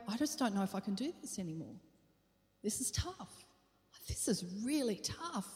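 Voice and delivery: soft, weary murmur of despair; despairing self-talk. Retold or imitated. Driving home alone, softly despairing to herself. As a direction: murmur it like you're giving up. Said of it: "there were drives where I would go".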